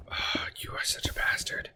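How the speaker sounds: hissed under the breath at the game; whispered curse. Real, not acted. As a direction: whisper the insult to the screen. Cursing the game under his breath.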